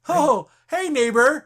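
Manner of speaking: high, loud sing-song greeting; chirpy neighbor. Retold or imitated. Agnes brainwashed again, greets Vision in high cheery tone. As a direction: snap back to sunny small-town cheer.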